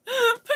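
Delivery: a loud squeal of laughter; loud high laugh. Real, not acted. Amused by the viewer count, loud squealing laugh.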